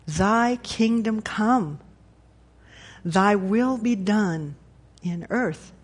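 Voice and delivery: measured, deliberate recitation, weighing every phrase; very slow prayer. Real, not acted. Pastor closing with the Lord's Prayer, very slowly. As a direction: pray slowly, with long pauses between phrases. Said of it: "let's say it very slowly".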